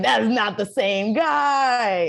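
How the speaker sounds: high, loud, stretched-out amazement; slow, emphatic incredulity; retold disbelief. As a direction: stress every word in mock shock. Retold astonishment at casting.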